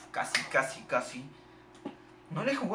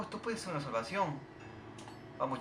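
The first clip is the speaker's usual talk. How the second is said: ordinary talk, nothing marked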